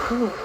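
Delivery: long breathy whew, fanning off the heat; flustered sigh. Real, not acted. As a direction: blow out air like it is too hot in here. Flustered by her flirty talk.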